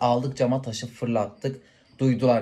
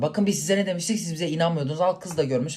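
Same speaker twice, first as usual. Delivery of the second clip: gloating told you so; 6 semitones higher than usual. Retold or imitated. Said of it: "falan yaptık böyle".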